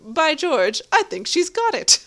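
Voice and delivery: high, loud theatrical exclamation; My Fair Lady quote. Acted. Co-host quoting My Fair Lady, hammed-up and exultant. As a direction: declaim it like a triumphant musical line. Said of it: "which is from My Fair Lady".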